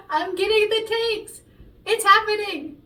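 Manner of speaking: loud, high thrilled burst; bursting with real joy; excited. Real, not acted. Singer celebrating good takes, loud and excited. Thrilled about recording going well.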